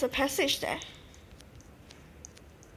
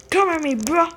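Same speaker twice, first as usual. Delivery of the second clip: higher, louder taunt, calling the enemy out; loud mock challenge; 4 semitones higher, 8 dB louder than usual. Gamer daring the enemy bug to fight, playful loud taunt. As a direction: shout a cocky dare at the bug.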